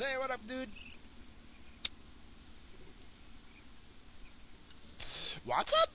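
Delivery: high, soft hey to a passing driver; high-voiced casual hello; high-pitched greeting. Real, not acted. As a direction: greet a stranger in a high little voice. Greeting another driver from car.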